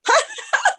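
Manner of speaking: high startled laughing shriek. Real, not acted. Caught off guard by the unsafe-date question, high shrieking laugh.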